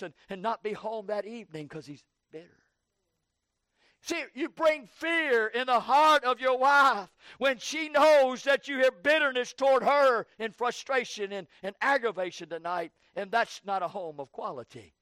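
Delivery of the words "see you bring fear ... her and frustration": hammered sermon cadence; fiery preaching. Acted. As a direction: drive it home to the husbands from the pulpit. Preacher rises to full force.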